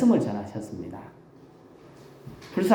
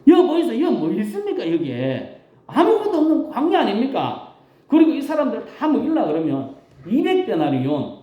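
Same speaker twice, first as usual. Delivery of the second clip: flustered disciples; 7 semitones higher than usual. Retold or imitated. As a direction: protest in disbelief. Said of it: "제자들이 한 말은 이렇습니다".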